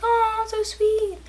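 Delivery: slow, high, sweetly put-on voice; sugary high thanks. Thanking the lady who praised her, in a slow sugary voice. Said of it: "I was like".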